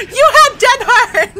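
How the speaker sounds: talking through loud laughter; laughing. Real, not acted. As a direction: laugh out the words. Laughing at teammate.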